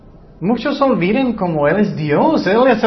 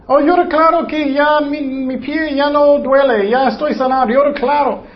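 higher, mock-triumphant healing claim; mocking quote; 6 semitones higher than usual. Preacher parodies believers claiming healing, high mock voice. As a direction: ham up a believer's confident decree. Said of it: "muchos dicen declaring healing".

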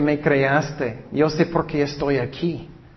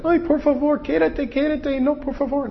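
high, whiny begging voice, much higher; mock pleading; 12 semitones higher than usual. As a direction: beg like a desperate clingy preacher, hammed-up. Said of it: "Él no era". (súplica burlona, aguda y lloriqueante)

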